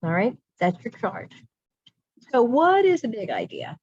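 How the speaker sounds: usual speaking voice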